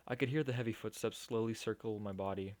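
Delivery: ordinary talk, nothing marked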